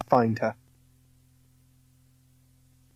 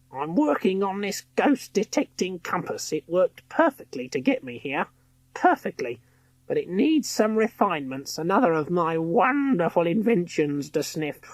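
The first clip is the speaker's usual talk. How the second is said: high, proud inventor voice; boastful villainess; 6 semitones higher than usual. A narrator's reading. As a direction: gloat over her invention.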